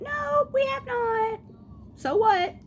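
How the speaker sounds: very high, exaggerated comeback; mock-defiant retort. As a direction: throw it back in a squeaky mock protest. Acts answer to nosy question.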